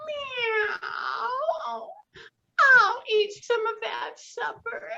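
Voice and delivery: very high, slow meowing cat; a sweet cat angling for supper. Acted. Cat waking up for supper, high and slow. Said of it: "said the cat".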